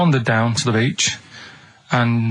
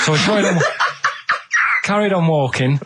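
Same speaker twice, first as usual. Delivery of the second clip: laughing; 5 semitones higher than usual. Real, not acted. Narrator cracks up mid-story.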